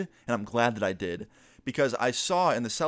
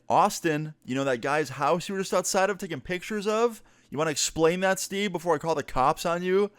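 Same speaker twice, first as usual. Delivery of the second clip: pitched-up, mock-stern phone confrontation; angry confrontation; 6 semitones higher than usual. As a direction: call him out, pushing higher.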